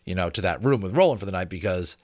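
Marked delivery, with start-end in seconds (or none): none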